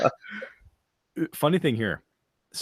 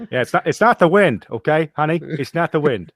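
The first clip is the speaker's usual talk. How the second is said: mock reassuring; 6 semitones higher, 3 dB louder than usual.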